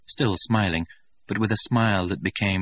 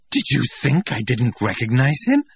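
higher and louder, offended; indignant; 7 semitones higher, 6 dB louder than usual. A narrator's reading. Linda defending herself before the staring crowd, indignant. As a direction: bristle at the very idea. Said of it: "Linda asked indignantly".

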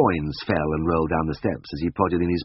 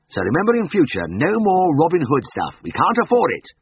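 put-on raised, firm older colleague voice; Siegfried's stern lecture; 11 semitones higher, 4 dB louder than usual. A narrator's reading. Senior vet warning his assistant to stop giving charity, firm. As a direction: lay down the law kindly but firmly.

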